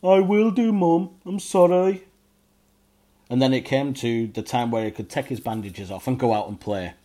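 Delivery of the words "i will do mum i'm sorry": high little goblin voice saying sorry; goblin apology. A narrator's reading. Ugnok apologising to mum, high goblin voice. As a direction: apologise like a told-off child. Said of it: "Ugnok says".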